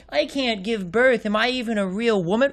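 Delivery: whiny heroine parody. Retold or imitated. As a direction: drag out the self-pity mockingly. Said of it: "to a woman who's like".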